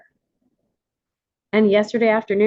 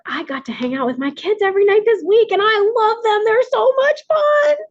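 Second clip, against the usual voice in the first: real gushing excitement; 13 semitones higher, 5 dB louder than usual. Real, not acted. Gushing about time with her kids.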